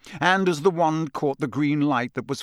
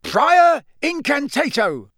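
roaring spell; 14 semitones higher, 7 dB louder, slower than usual. Acted. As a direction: spell roared high and loud. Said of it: "roared Mister Diggory".

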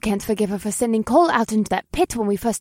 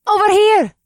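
high, loud call across the room; bright-pitched hailing shout; crying out; 12 semitones higher, 10 dB louder than usual. Acted. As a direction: shout to get a friend's attention. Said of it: "A familiar voice cried out".

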